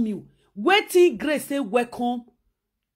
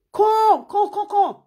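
eager calling; 7 semitones higher, 4 dB louder than usual. Retold or imitated. Mock calling someone over.

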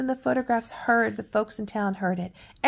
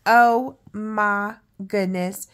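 drawn out slow and much louder; amazed; 7 dB louder, much slower than usual. Real, not acted. Grandma showing the child Holly's funny drawing, loud drawn-out amazement. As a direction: gasp it out in delight. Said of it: "This is what Holly looks like".

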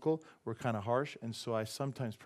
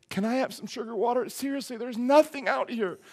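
lightly voiced begging bird; 11 semitones higher, 4 dB louder than usual. Voicing a hummingbird begging.

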